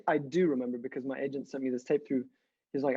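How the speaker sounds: ordinary talk, nothing marked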